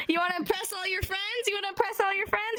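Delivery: quick, high-pitched eager pitch; playful excitement. Real, not acted. Teacher sells students a party trick, excited and quick. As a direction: sell it fast and high, fresh from laughing. Playfully selling the tongue twister.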